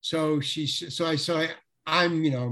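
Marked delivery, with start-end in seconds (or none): none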